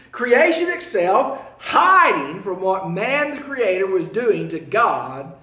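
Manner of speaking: loud, high sermon delivery; preaching. Acted. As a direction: paint the darkness with full voice. Preaching builds to darkness.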